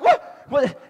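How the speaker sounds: high, startled yelp of "what"; retold freak-out. Pastor startled by a parrot beside him, acted freak-out. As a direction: re-enact being suddenly spooked. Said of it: "And I freak out".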